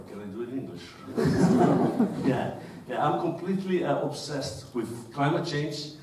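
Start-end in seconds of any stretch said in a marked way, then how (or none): 1.2-2.2 short burst of sheepish laughter; embarrassed laugh. Real, not acted. Embarrassed switch to English.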